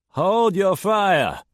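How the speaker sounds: loud, high, slow bellow; bellowed order. A narrator's reading. Man bellowing at the shooters to stop. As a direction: bellow it across the canyon. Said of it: "a man bellowed".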